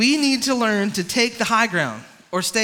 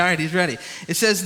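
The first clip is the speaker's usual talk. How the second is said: normal talking voice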